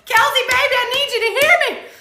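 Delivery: high, loud yell breaking into laughter. Real, not acted. Host pleading with Kelsey to hear her, laughing yell. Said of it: "I can't stop yelling at you".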